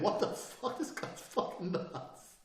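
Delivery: unvoiced, drawn-out whispery reaction; stunned disbelief. Frightened people judging the sudden change, stunned. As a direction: whisper it slowly in shock. Onlooker's alarmed thought.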